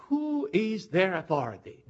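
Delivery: ordinary talk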